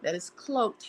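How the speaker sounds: normal talking voice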